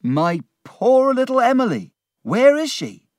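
high, loud anguished plea; urgent outcry. Acted. Peggotty learns that Emily has left, urgent outcry. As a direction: cry out for a lost loved one.

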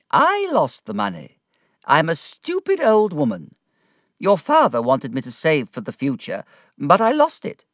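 aunt admitting she lost her own money, self-reproaching; self-scolding old aunt. A narrator's reading. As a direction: confess it briskly, calling herself stupid. Aunt confesses her loss.

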